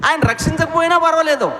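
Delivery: high and loud, unshaken; defiant shout. Acted. The youths telling the king they would rather die, defiant shout. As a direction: shout your refusal to bow.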